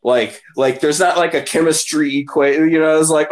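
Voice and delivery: ordinary talk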